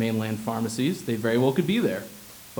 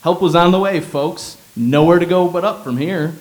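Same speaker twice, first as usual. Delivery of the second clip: loud, higher announcer-style hero doctor; heroic doctor; 4 semitones higher, 7 dB louder than usual. Pretend. The dream doctor reassures the folks, loud hero announcement. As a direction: boom it out like a rescuer arriving.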